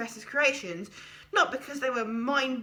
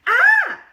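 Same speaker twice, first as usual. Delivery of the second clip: short shrill scream of terror, much higher and louder; terrified scream; 15 dB louder than usual. A narrator's reading. Stitchhead waking to the creature's eye, terrified scream. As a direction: shriek in sudden fright. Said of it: "screamed Stitchhead in terror".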